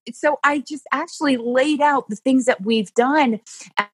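ordinary talk

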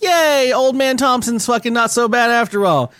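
put-on high, gleeful child voice; cheering kids. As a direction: cheer like a delighted kid. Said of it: "And they're like".

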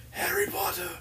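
high, strained villain cry; Voldemort voice. Retold or imitated. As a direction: wail the name like a dark wizard. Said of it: "talk like Voldemort".

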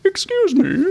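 high prissy squeak for a talking sphincter; high offended sphincter voice. Pretend. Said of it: "Some of our sphincter was like".

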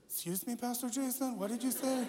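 a squeaky disbelieving geek; Kip voice, startled. Retold or imitated. Youth leader shocked by pastor's advice, high Kip voice. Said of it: "he sounded just like Kip".

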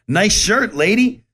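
calling out cheerfully across a parking lot; shouting a compliment from the car to a stranger; friendly yell. Retold or imitated. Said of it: "I yelled out".

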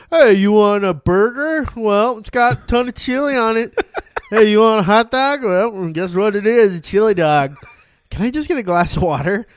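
loud, high hammed-up salesman pushing chili; pushy chili vendor. Tommy's worker pushing chili on every order, loud and high. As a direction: oversell the chili like a desperate counter guy. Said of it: "they're like".

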